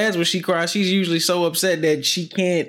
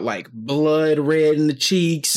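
ordinary delivery

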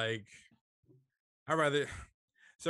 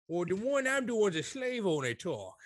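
Southern slave owner talk; 6 semitones higher than usual. Acted. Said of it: "That's when you do a little slur".

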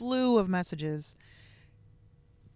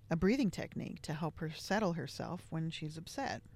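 normal talking voice

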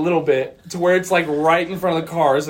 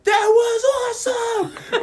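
high, slow, stretched cheer; whooping with wild excitement; excited shout; slower than usual. Retold or imitated. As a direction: yell it in triumph. Friends celebrating the prank.

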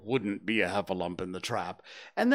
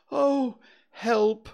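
trapped bear's high, loud plea; Pooh; 10 semitones higher, 7 dB louder than usual. A narrator's reading. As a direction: call for help from inside a jar. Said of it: "he said inside the jar".

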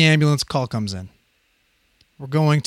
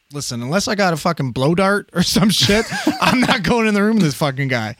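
laughing refusal; 4 semitones higher than usual. Retold or imitated. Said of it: "I'm like".

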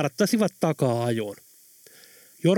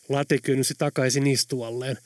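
ordinary delivery, nothing marked

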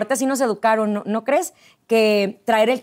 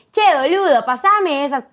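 singsong Buenos Aires accent, pitched up; strong porteño accent; 4 semitones higher, 3 dB louder than usual. Shown as a demonstration. Wife showing friends the Buenos Aires accent, singsong porteño accent. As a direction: lilt it like a very porteño speaker. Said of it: "como que el muy porteño".

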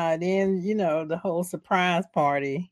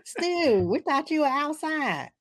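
mock surprise; 9 semitones higher than usual. Sidney voiced high.

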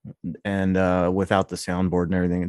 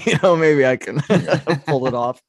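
laughing; 5 semitones higher, 5 dB louder than usual. Real, not acted. Laughs through the joke.

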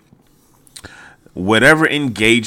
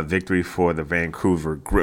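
normal talking voice

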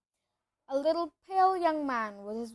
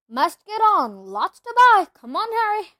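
much louder, higher call over the crowd; 8 semitones higher, 13 dB louder than usual. A narrator's reading. Hagrid calling Harry away, loud over the crowd. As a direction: make himself heard over the babble.

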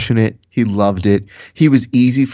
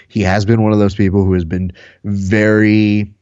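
ordinary talk

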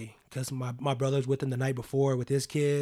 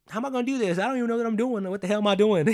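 much higher, mock-panicked inner voice; panicked self-doubt; 8 semitones higher, 5 dB louder, faster than usual. A beginner filming basketball doubts himself, high mock panic. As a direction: throw up your hands, high and flustered. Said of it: "this is like".

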